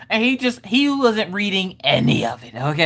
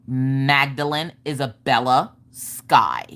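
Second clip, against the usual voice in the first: slow, low sassy recital; super sassy; 6 semitones lower, slower than usual. Retold or imitated. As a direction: draw it out with childish self-importance. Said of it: "She's super sassy".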